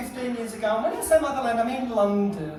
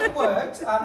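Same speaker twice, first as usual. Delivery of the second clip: ordinary talk, nothing marked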